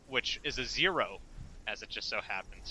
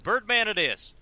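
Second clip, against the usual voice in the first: character voice; 10 semitones higher, 7 dB louder than usual. Acted. Character repeats chosen name.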